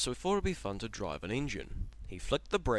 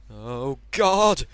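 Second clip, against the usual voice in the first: much louder cry; shout; 4 semitones higher, 13 dB louder than usual. Acted. Henry moving onto the main line, loud shout. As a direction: cry out in dread. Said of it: "he shouted".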